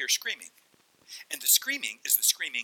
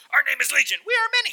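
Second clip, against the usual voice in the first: very high, loud demon shriek; piercingly high, loud possessed voice; 12 semitones higher, 6 dB louder, faster than usual. Acted. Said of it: "what did he sound like?".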